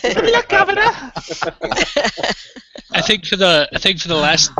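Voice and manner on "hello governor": high-pitched laughing London greeting; cheery London hello through a laugh; mock London accent. A friend greets in a mock London accent. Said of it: "Why does everybody say Governor?".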